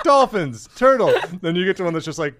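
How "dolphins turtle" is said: mock tour guide. Acting as an aquarium visitor.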